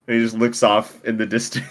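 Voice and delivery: usual speaking voice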